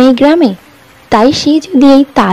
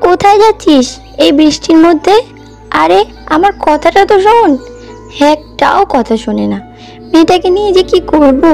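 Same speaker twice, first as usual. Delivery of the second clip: higher-pitched exasperated elder sister; exasperated scolding; 5 semitones higher than usual. Acted. Sister calling after girl running into the rain, exasperated. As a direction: scold a child who won't listen.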